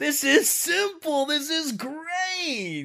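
high, slow, laid-back surfer drawl; cool dude God. Acted. God in sunglasses showing Glyndor the battle, high and laid-back. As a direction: stretch it out like a chill dude in shades.

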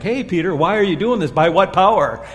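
mocking interrogators. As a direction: play the challengers poking at Peter. Leaders challenging Peter, mock voice.

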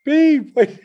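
high, loud mock holler; high-pitched comic retold yell; imitated yelling. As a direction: mimic girls crying out to wait. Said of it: "these girls are yelling".

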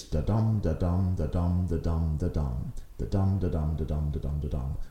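da-dum with the weight on dum; iambic beat. Shown as a demonstration. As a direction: tap out the iambic rhythm, fading toward the end. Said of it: "the rhythm goes like this".

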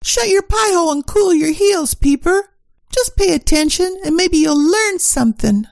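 loud, bossy scolding; bossy snap. Acted. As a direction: put him in his place, loudly. Secretary bosses detective around.

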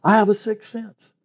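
high, lightly mocking woman's voice; a hint of a breezy wannabe investigator; mimicking applicants. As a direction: send up the eager would-be detective. Mimicking hopeful female applicants.

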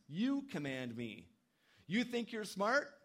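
mock them: you think you're smart?; sarcastic God. God mocking people who command him, sarcastic. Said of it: "a sarcastic comment here".

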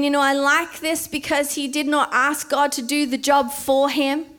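ordinary delivery

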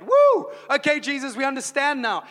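high, loud whoop then cheer; mock cheering crowd. Preacher mocks people cheering Jesus instead, high loud whoop.

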